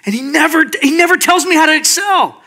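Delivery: frustrated. Acted. Man complaining the Father never explains, frustrated. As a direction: pile up the grievance, pitched high. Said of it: "And I get so frustrated".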